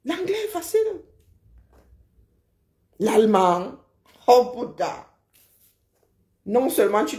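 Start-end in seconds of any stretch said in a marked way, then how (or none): 0.1-1.0 playful high-pitched repeat; mock high echo. Real, not acted. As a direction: repeat it much higher, lightly mocking. Mocking repeat of own line.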